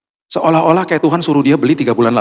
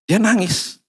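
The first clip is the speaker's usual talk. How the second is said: usual speaking voice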